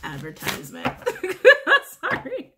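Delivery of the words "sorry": high giggling apology; real laughter. Real, not acted. Reader cracking up at her guides, giggling apology. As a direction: giggle through the sorry, pitched way up. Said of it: "my guides are so funny today".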